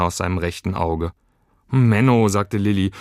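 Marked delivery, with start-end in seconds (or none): 1.7-2.3 put-on louder, higher childish whine; whiny protest. A narrator's reading. Girl upset at seeing creature cry, whiny.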